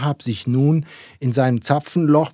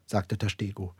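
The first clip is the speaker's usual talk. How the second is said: plain reading voice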